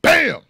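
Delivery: sudden, loud and high "bam!". Retold or imitated. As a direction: slam the word out like a door hit. Door opened with forehead.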